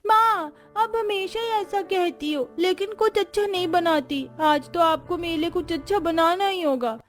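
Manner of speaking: put-on high, pleading child whining for treats; little girl's voice. Little daughter Komal pleading. (ऊँची, ज़िद करती बच्ची की आवाज़)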